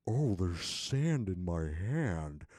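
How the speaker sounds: slow, lost in wonder at his hand; slow dazed marvel. Retold or imitated. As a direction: stare at it slowly, stunned. Dazed quote while hallucinating.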